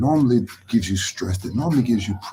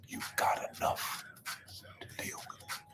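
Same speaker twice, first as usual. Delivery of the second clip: whispered reassurance; 19 dB quieter than usual. Shown as a demonstration. Preacher assuring the hurting they have enough, in a whisper. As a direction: breathe it out with no voice.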